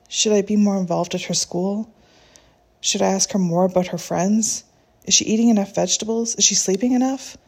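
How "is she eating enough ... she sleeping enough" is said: usual speaking voice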